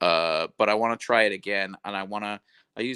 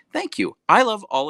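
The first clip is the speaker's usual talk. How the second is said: much higher, bright burst of thanks; delighted thanks; 11 semitones higher, 8 dB louder than usual. Real, not acted. Thanking Sticker Dude for his kind message, delighted. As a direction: gush your thanks.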